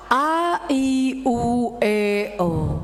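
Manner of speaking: empty the lungs over the vowels; breath fully emptied. Shown as a demonstration. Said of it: "Habis napasnya teh".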